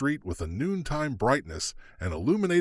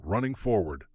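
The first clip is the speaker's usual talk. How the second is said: ordinary delivery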